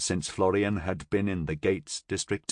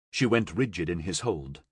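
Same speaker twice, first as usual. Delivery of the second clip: usual speaking voice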